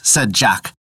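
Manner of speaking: ordinary delivery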